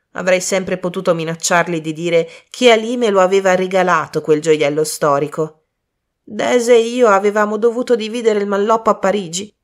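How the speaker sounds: angry outburst. A narrator's reading. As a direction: rant furiously about the man who dumped you. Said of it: "Poi cambiò tono bruscamente". (sfogo rabbioso e indignato)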